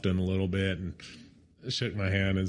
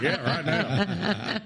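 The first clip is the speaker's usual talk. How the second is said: laughing through it; 5 semitones higher, much slower than usual. Retold or imitated. Laughing retelling.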